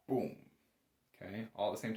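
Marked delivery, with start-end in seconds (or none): none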